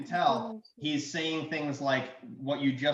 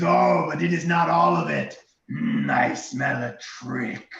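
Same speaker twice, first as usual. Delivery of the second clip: dragon voice; 8 dB louder than usual. Acted. Dragon noticing the rock under gold, dragon voice. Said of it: "And he goes".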